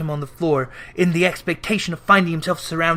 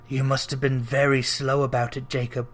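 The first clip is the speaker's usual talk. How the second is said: businesslike, humble; 5 semitones lower, 5 dB quieter than usual. Acted. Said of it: "in a businesslike manner, though with humility".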